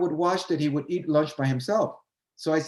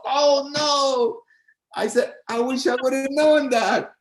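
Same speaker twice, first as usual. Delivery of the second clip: dismayed oh no; 10 semitones higher, 5 dB louder, slower than usual. Retold or imitated. Learns the part is shorter.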